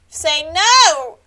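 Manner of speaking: loud urgent shout; shout at screen. Real, not acted. Streamer yelling at the game character: loud urgent shout. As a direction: yell the advice at the screen.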